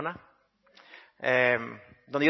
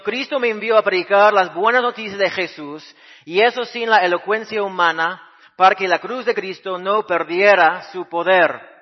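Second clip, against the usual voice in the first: higher, louder preaching of Paul's words; 5 semitones higher, 7 dB louder than usual. Acted. Pastor paraphrases Paul for the congregation, raised preaching voice. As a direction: proclaim it to the church with conviction.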